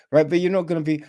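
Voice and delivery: ordinary delivery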